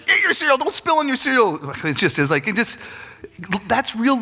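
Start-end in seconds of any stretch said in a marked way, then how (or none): 0.1-1.7 high-pitched mock nagging; frazzled parent. Parent nagging a child at breakfast, high and exasperated. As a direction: order the kid about in a frantic high voice.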